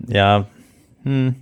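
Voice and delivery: ordinary talk, nothing marked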